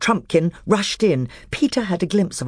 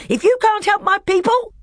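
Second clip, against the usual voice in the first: rising scream. Acted. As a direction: let it climb into a scream. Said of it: "whose voice now rose to a scream".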